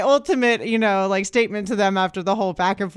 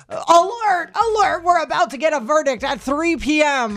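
alarm alert voice; 7 semitones higher than usual. Retold or imitated. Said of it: "my phone's blowing up".

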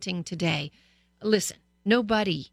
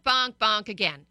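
ordinary delivery

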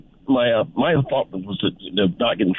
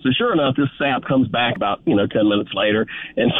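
ordinary talk

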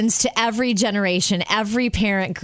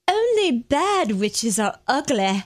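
put-on a botched old-Hollywood transatlantic accent, pitched up; mock transatlantic movie voice; failed transatlantic accent; 4 semitones higher than usual. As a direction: try for a 1930s film accent and miss. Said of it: "it's not Southern accent".

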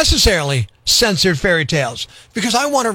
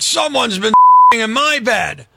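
slow, louder bear growl; deep bear growl; 3 semitones higher, 4 dB louder than usual. Acted. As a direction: growl it slowly like a big bear. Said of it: "Papa Bear growled".

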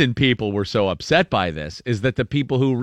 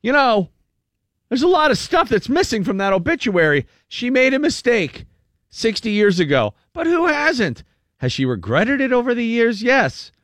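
mock grieving relative; 9 semitones higher than usual. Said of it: "And one relative said".

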